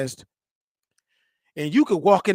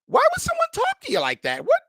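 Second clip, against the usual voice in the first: very high, loud mock disbelief; high-pitched imitation of an appalled girlfriend; shocked girlfriend; 6 dB louder than usual. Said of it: "she was looking at me like".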